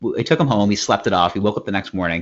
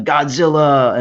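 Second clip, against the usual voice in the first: slow, raised mock yell, higher and louder; imitated yell; 5 semitones higher, 5 dB louder, slower than usual. Telling friend what he was yelling, imitated drunken yell. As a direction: draw the name out in a mock yell. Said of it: "You were, like, yelling".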